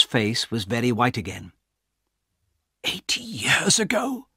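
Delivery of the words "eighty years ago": horrified breathy whisper. Acted. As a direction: whisper it, barely able to speak. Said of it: "he whispered".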